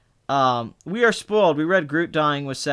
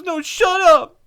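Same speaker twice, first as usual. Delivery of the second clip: high, loud mock outburst; hammed-up upset snap; dramatic moviegoer; 14 semitones higher, 6 dB louder, slower than usual. Mimicking the guy snapping at his friend, dramatic. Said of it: "at this dramatic moment".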